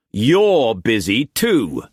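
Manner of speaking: slow, lightly voiced Scottish man; a hint of a dry Scot, slowly; Struan's voice. A narrator's reading. Struan teasing his mistress back, slow and dry.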